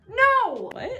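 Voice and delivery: high, loud drawn-out no; exaggerated indignant yell; mock outrage. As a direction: shout it in comic disbelief. Mock outraged answer to own question.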